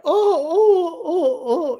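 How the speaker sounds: high-pitched imitated boo-hoo; exaggerated crybaby "oh"s, very high; mock whimpering wail. Mocks young X's upset reaction.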